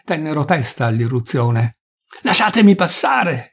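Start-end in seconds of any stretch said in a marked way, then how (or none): 2.2-3.5 high, urgent cry to get through; shouting through crowd. A narrator's reading. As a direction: demand passage from a crowd, pitch raised. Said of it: "gridava tra la ressa". (grido per farsi largo)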